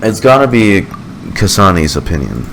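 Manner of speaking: usual speaking voice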